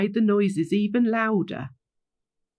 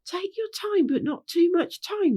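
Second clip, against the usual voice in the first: much higher mimicking voice; echoing back; 10 semitones higher than usual. A narrator's reading. Pinky Bow repeating Rainbow's words, high-pitched echo. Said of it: "repeated Pinky Bow".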